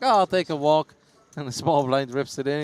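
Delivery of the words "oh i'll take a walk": mock casual fold. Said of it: "you're like".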